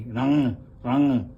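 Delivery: रंग with a nasal ng at the end. Shown as a demonstration. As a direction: say रंग through the nose. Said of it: "इसकी नास्की sound आएगी". (रंग नाक से बोलना)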